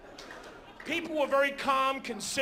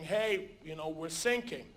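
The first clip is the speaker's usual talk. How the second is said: calm, low-key; 5 semitones lower, 5 dB quieter than usual. Retold or imitated. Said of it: "not screaming or anything".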